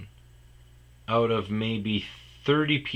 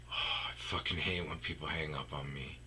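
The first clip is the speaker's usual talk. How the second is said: annoyed muttering; 7 semitones lower, 12 dB quieter than usual. Retold or imitated. Annoyed muttering after hang up.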